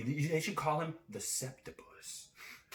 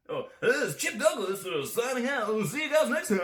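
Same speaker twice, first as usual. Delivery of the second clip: announcer sign-off; 9 semitones higher, 6 dB louder than usual. Pretend. Sign-off as Chip Douglas.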